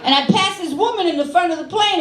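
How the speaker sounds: normal talking voice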